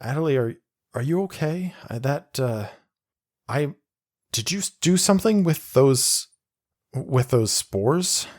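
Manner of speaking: a lightly voiced anxious young pilot; Lucius, worried. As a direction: stammer it out, worried about her. Lucius worried, asks Adelie.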